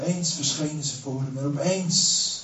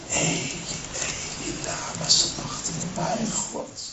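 hushed, anguished gasps; broken whispered cry; 10 semitones higher, slower than usual. Acted. Jesus, forsaken, whispers his anguish to God. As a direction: let the cry break into breath.

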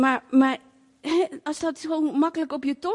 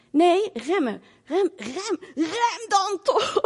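higher, louder, insistent brake command; urgent retold shout; 7 semitones higher, 3 dB louder, much slower than usual. Brother urging his sister to brake, raised urgent voice. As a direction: yell at the learner driver to brake now.

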